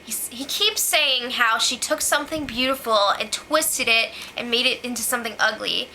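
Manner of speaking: ordinary delivery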